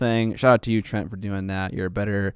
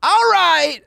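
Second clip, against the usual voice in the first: loud high announcer reset; 6 dB louder than usual. Real, not acted.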